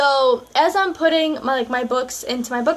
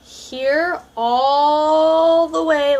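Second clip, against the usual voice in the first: very slow, louder, drawn-out "all the way"; stretched out; 3 semitones higher, 4 dB louder, much slower than usual. Acted. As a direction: stretch the words to show the long distance. Showing how far the locker was.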